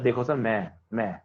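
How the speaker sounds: a lightly voiced eager kid volunteering in a low, soft voice; eager IIT topper. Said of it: "IIT advance निकालने वाला बच्चा आगे और वो बोलता है".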